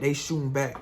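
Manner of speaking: ordinary delivery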